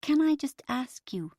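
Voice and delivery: gentle nasal voice. A narrator's reading. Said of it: "interrupted a gentle nasal voice".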